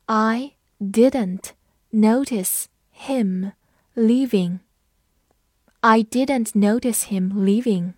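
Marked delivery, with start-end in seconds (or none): 0.1-4.6 very slow, each word on its own; word-by-word teaching pace with gaps; slow read-along. Shown as a demonstration. Teacher has learners repeat after her, slow separated words. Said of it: "跟我慢读一遍".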